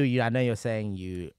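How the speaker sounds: ordinary talk, nothing marked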